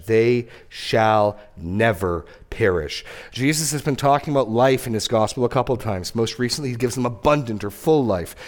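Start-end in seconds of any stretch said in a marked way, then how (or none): 0.1-3.1 They shall never perish, very slow with never and perish leaned on; emphatic scripture line. Shown as a demonstration. Preacher pointing congregation to the emphatic promise, slow and stressed. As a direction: stretch never perish out, weighty. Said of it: "notice the emphatic language".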